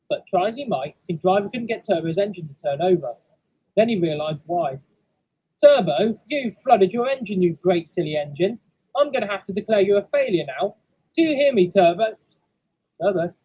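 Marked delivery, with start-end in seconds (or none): 5.6-12.2 loud, raised telling-off; exasperated scolding. Acted. Driver berates engine for flooding itself, exasperated. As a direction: scold a silly engine firmly.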